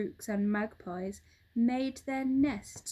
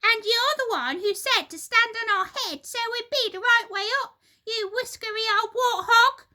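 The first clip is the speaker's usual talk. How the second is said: high, loud furious scream; screaming wife; 12 semitones higher, 8 dB louder, faster than usual. Acted. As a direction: fire back at the husband. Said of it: "screamed Missus Twit".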